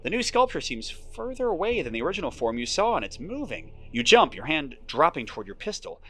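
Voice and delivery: steady storyteller read; moody game narration; story narration. A narrator's reading. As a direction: draw the listener into the fog. Narrates approaching the fountain.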